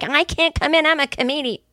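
high, indignant self-important whine; entitled whine. Retold or imitated. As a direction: whine it like a snubbed diva. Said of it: "And I'm like".

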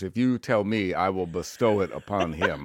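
grand narrator granting a favour; playful veteran actor impression; Morgan Freeman. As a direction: bestow it like a stately narrator. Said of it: "Morgan could".

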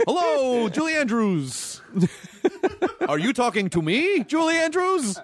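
high, hammed-up movie star impression; drawn-out, lilting mock princess voice; Hathaway. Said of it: "No, that's my Hathaway".

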